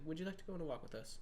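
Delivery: quick, flat deadpan line reading; monotone voice. Retold or imitated. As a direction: rattle it off with no emotion. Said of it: "it's very monotone".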